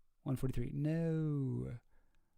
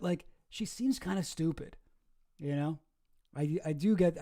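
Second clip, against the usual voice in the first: ordinary talk, nothing marked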